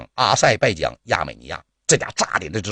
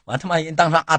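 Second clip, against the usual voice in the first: ordinary talk, nothing marked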